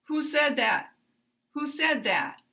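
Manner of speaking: high start on "Who", dropping on "that"; start high, then fall. Shown as a demonstration. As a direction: stress all of "Who said that", falling at the end. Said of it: "going to start high".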